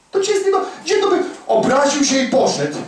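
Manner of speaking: usual speaking voice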